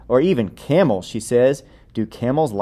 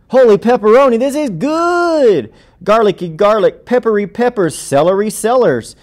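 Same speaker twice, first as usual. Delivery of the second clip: thrilled exclamation; 9 semitones higher, 4 dB louder than usual. Acted. Tastes amazing food, thrilled.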